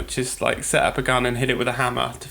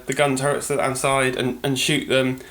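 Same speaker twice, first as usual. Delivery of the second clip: normal talking voice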